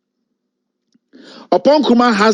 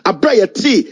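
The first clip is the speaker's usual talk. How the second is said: usual speaking voice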